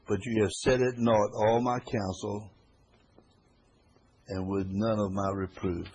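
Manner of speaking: loud and slow, weighty reproach; solemn scripture reading. Acted. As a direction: drag the words out with weight. Reads scripture aloud.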